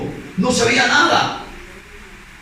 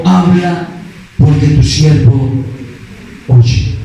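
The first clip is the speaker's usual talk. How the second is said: low, slow, weighty reply; solemn answer; 4 semitones lower, much slower than usual. Acted. Preacher voicing Samuel answering God, solemn. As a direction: answer in a firm, lowered voice with solemn pauses.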